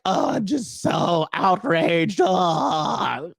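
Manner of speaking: high, drawn-out mock wail; mock outrage. Imitating men offended by a song lyric, mock outrage. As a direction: moan it slowly as a hammed-up complainer. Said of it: "And they're like".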